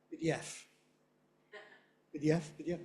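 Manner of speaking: "P D F" with short, unstressed first letters; underpronounced letters. Retold or imitated. Teacher imitating non-native speakers, underpronounced letters. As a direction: squash the letters of "P D F" together. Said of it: "speakers of other languages saying things like".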